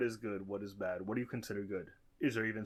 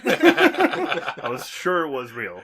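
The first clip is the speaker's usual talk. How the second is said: loud, drawn-out, breaking into real laughter; amused at your own mistake, laughing loudly; 4 semitones higher, 13 dB louder, much slower than usual. Real, not acted. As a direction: laugh right through the words.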